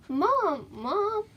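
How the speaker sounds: put-on a child calling for her mom, panic rising. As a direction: call out with growing panic. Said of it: "a note of panic rising on her voice".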